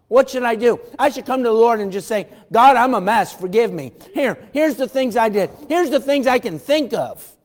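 loud, high impassioned plea; a preacher urging sinners to confess, loud and high; fiery preaching. Acted. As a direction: preach it full force, begging for mercy. Preaching at full force.

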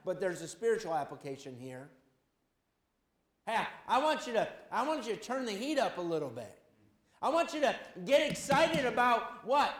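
usual speaking voice

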